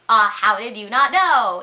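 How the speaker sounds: playfully exaggerated disbelief; joking voice. Shown as a demonstration. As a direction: tease loudly, pretending to be appalled. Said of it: "I'm using my joking voice".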